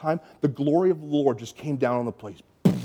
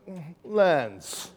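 higher, slow, mocking put-on voice of the other camp leader; a lightly mocking impression of a rival leader; imitating the other leader; 6 semitones higher, slower than usual. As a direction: drawl it out slowly, pitched up, teasing the Canadians. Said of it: "and he goes".

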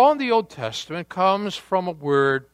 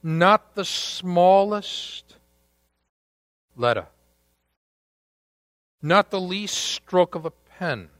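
slow dramatic reading. Real, not acted. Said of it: "With that dramatic pause".

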